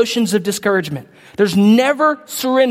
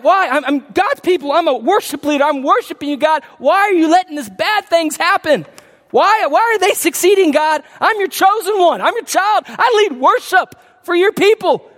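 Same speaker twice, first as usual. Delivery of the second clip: raised-pitch protest to God, building as it goes; acted-out complaint, climbing higher; indignant pleading; 5 semitones higher than usual. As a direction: plead your case like a wronged believer. Acting out a worshiper's protest to God.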